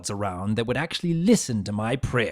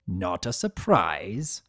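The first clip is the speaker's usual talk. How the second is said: plain reading voice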